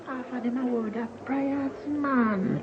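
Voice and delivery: high mocking voice.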